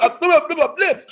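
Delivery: high, giggling insistence; light comic laughing; laughing plea. Retold or imitated. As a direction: laugh while asking. Numb lips, retold.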